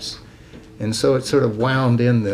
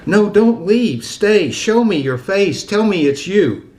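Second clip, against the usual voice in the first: higher, louder imploring recital; short insistent pleas, raised in pitch; urgent pleading; 7 semitones higher, 4 dB louder, slower than usual. Acted. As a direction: plead with a vanishing loved one to stay. Poem's plea to vanishing ghost.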